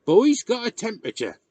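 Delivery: loud, high, curt cut-in; brusque old man interrupting; curt interruption. A narrator's reading. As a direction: cut in sharply and loudly. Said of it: "interrupted Tom curtly".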